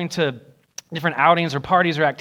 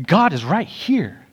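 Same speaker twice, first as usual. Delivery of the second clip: voicing Jesus earnestly; 5 semitones higher than usual.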